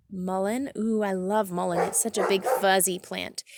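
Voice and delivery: ordinary talk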